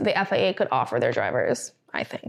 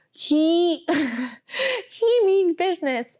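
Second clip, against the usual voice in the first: much higher, very slow, with a laugh; mock stern head boy; 7 semitones higher, much slower than usual. Host mocking George Russell as stern head boy, higher and slow. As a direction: ham up a prim, serious prefect.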